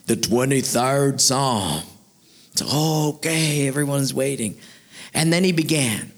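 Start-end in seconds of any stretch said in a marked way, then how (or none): 0.0-1.8 large, slow booming voice; slow Shakespearean proclamation; booming orator. Acted. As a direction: announce it grandly, drawing it out. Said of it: "in a large booming voice".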